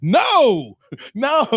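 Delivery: loud, swooping no; mock refusal. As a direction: blurt a big comic refusal. Answers in the quoted sketch.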